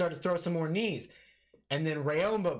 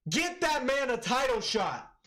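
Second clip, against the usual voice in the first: excited call; 9 semitones higher than usual. Real, not acted. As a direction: demand it fired up. Hyped after the knockout.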